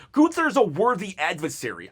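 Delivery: normal talking voice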